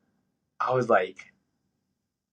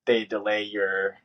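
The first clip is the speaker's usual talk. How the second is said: normal talking voice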